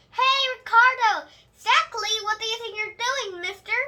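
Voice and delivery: high, loud, bossy yell; loud indignant holler. Acted. Junie yells at Ricardo for chasing Selma. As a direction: call him out across the grass. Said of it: "I hollered real loud".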